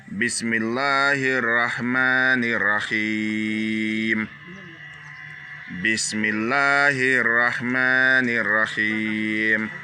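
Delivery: Bismillahir Rahmanir Rahim slow with long vowels held; slow demonstration recitation; lengthened vowels. Shown as a demonstration. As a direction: stretch the doubled vowels. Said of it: "Quand je double les voyelles, ça veut dire qu'on doit tirer".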